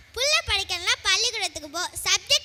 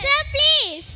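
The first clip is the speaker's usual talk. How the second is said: higher, louder plea; urgent pleading; 4 semitones higher, 5 dB louder than usual. Acted. Traveller keeps begging the man who refuses, urgent pleading. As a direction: beg over the refusal.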